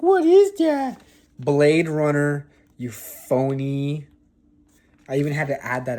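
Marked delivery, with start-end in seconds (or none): 0.0-1.0 high mock voice. Mocking viewers' reaction.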